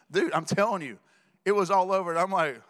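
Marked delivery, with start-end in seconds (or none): none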